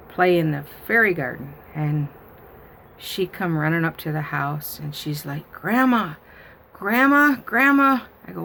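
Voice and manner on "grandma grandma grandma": put-on high, louder child calling Grandma; excited little girl. Granddaughter running up, calling her grandma excitedly. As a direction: call out like an eager little girl.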